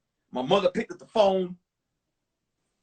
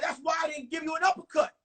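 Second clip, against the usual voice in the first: quick, higher imitation of dad; father's voice; 8 semitones higher, faster than usual. Dad explaining to his son why he held back, quick and high. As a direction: rattle it off like a fed-up father. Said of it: "He said".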